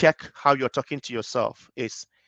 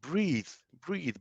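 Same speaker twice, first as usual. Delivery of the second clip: softer, gentle breathing cue; soft calming breathe; 3 semitones higher, 8 dB quieter than usual. Shown as a demonstration. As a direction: coax the breath out quietly. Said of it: "breathe your way to clarity".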